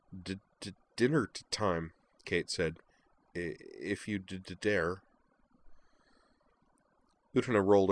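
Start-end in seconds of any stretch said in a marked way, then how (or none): 0.1-1.9 soft, stammered announcement; stuttering Kate. Acted. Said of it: "Kate's stutter". 3.4-5.0 soft, stammered announcement; stuttering Kate. Acted. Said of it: "Kate's stutter".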